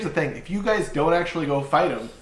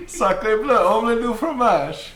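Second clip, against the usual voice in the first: loud high French chef voice; hammy mock French accent; 8 semitones higher, 6 dB louder, slower than usual.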